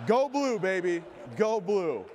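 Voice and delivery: loud, high fan chant; sports cheer. Real, not acted. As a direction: yell it like a stadium fan.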